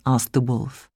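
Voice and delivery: ordinary delivery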